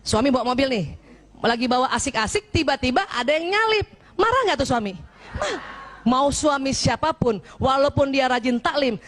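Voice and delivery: ordinary talk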